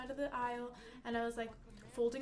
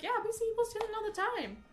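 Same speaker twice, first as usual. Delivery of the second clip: higher and louder, breezy don't-care shrug; careless coworkers; 6 semitones higher, 5 dB louder than usual. Retold or imitated. Coworkers telling the new girl they never stop shoplifters, breezy and careless. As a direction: toss it off like it's nothing. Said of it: "was their attitude".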